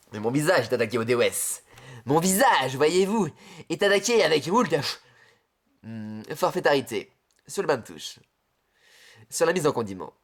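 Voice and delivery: low pompous Adrien voice. Acted. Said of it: "dis-le, Adrien".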